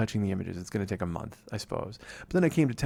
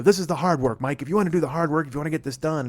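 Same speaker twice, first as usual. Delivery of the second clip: imitating a stern taskmaster, higher and louder; mock lecture from the friend; hammed-up stern scolding; bossy helper; 5 semitones higher, 5 dB louder than usual. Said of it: "and she'd go".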